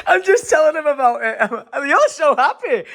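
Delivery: can't stop laughing while speaking. Real, not acted. Laughing about the beans story.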